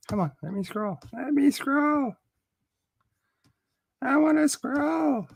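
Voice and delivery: put-on very high, childlike pleading whine; mock whining. Streamer pleads with a stuck browser, mock whining.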